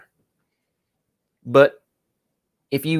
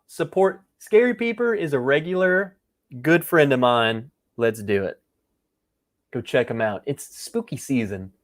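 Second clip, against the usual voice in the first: ordinary talk, nothing marked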